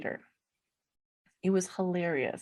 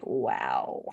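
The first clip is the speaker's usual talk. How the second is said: a very low, flat-toned wow; deadpan wow. Retold or imitated. Hanging up on the ex, a very low deadpan wow. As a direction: drop the wow way down in disbelief. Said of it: "and be like".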